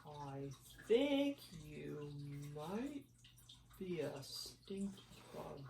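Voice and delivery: low, soft, very slow drawl; slow snail voice. Acted. The snail finally speaks, low and very slow. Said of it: "the snail said".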